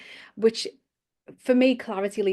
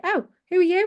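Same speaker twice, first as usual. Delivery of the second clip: high, loud surprised question; startled inner voice; 10 semitones higher, 6 dB louder, faster than usual. Acted. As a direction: act taken aback by a newcomer in your head. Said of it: "then this other person pops in".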